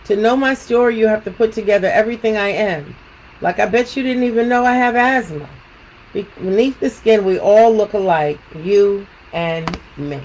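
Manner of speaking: measured storytelling with lilting questions; picture book read-aloud. Acted. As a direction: read to children, pausing after each thought.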